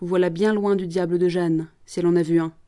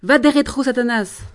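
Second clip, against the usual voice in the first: loud, higher cry of Va des rétro; shouted exorcism; 4 semitones higher, 5 dB louder than usual. Acted. Promoteur showing Jeanne how to shout at the devil, loud cry. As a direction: hurl the Latin at the devil. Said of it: "Il fallait crier".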